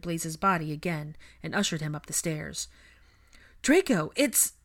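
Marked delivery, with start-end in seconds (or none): 3.6-4.5 high, loud call, broken off; shout cut short. A narrator's reading. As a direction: shout urgently, then break off. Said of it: "Pansy's shout was cut short".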